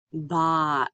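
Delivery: BOT drawn out slowly, final t separate; stretched out. Shown as a demonstration. Teacher blending sounds for students, BOT slowly stretched out. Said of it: "say it slowly and stretched out".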